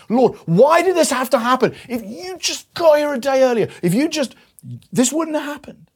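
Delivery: high, loud pleading cry of grief; anguished cry. Retold or imitated. Mary crying out to Jesus at his feet, high anguished plea.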